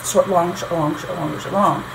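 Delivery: quick linked short-LONG pairs, fading; iambic rhythm. Shown as a demonstration. Teacher naming the pattern to keep, iambic rhythm. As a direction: rattle off "short, long" as a pulse, weight on LONG. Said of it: "same pattern".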